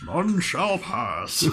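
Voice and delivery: high, drawn-out wizard's decree; Gandalf. Acted. Unpractised co-host tries the narrator's voice, Gandalf impression. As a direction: stretch it slowly like an old wizard barring the way. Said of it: "Mine was Gandalf".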